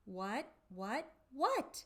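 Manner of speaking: ordinary delivery, nothing marked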